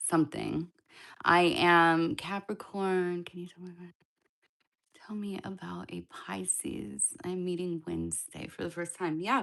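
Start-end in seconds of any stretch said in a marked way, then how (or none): 3.2-9.2 hushed reading. Real, not acted. Reading a viewer's chat question aloud.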